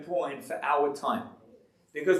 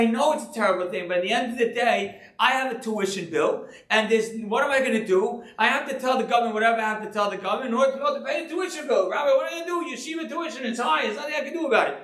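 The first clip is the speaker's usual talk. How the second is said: imitating the excuse-maker; 7 semitones higher, faster than usual. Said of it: "They say".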